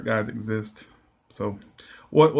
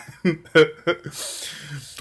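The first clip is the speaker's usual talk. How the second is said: high, falling chuckle; 5 semitones higher than usual. Real, not acted. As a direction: chuckle at your own story. Amused by own story.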